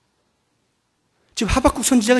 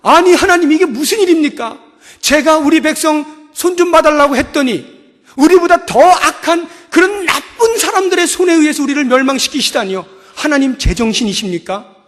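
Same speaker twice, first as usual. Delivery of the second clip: louder, pleading outcry to God; shocked protest; 7 semitones higher, 7 dB louder than usual. Acted. Prophet Habakkuk protesting to God, shocked and loud. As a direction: protest in disbelief, voice raised. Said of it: "하박국 선지자가 깜짝 놀랐습니다".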